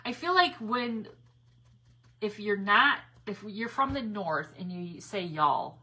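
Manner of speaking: ordinary talk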